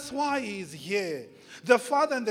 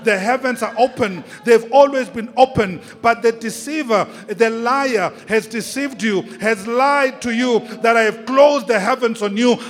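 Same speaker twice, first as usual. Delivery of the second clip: loud, forceful preacher voicing God; full-force preaching; 3 semitones higher, 13 dB louder than usual. Preacher speaks as God to a struggling son and daughter, full-force preaching. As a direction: thunder it out phrase by phrase.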